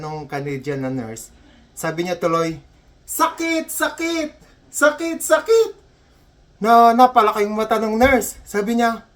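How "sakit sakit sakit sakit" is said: very high, hammed-up yelps; crying out in pain. Patient realizing nurse doesn't understand, yelping in mock pain. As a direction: yelp it, climbing higher each time.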